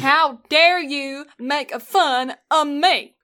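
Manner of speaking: hammed-up Southern offence; indignant Southern. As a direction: echo mock outrage in a Southern accent. Echoes the mock Southern outrage.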